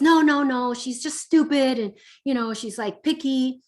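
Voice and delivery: a hint of a sulky teenager protesting; lightly voiced teen complaining about the teacher; defensive teen.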